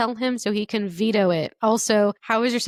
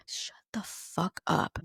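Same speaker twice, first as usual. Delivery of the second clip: whispered disbelief; hushed amazement; stunned whisper; 7 dB quieter, slower than usual. Real, not acted. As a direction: breathe it out in shock. Disbelief at a name.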